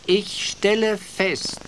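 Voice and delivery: very high, slow owl voice; high-pitched, drawn-out scolding; stern hissing owl. A narrator's reading. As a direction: pronounce it slowly in a high, reproving voice. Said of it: "zischte Eule und warf strafende Blicke".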